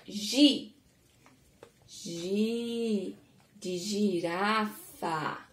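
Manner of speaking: very slow "gi", then "Gi de girafa" drawn out; teacher stretching "Gi de girafa" very slowly; slow syllable demo. Shown as a demonstration. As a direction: drag out "gi" and "girafa" for young learners. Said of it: "O G com a vogal I".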